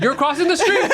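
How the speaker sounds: high mock yell, little added volume; yelling facts. Storyteller yelling facts at a fleeing thief, high mock yell. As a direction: pitch it way up like hollering an observation. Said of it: "continue yelling facts at him".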